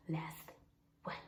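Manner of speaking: hushed whisper for drama. Real, not acted. Telling viewers she was last in line, whispered. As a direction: whisper it for suspense. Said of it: "I was the last one".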